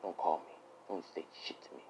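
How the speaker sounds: low, hushed, close-up threat; very soft and low, nearly whispering the insults; cold quiet menace. Acted. Deku to Uraraka as he brings her face closer, quiet menace.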